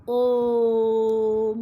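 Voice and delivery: ओम held long over three beats; prolonged Om. Shown as a demonstration. Teacher demonstrates the three-beat vowel in ओम, held long. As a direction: sustain ओम like a chant. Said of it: "बोल के उच्चारण करके देखिए तो तीन मात्रा".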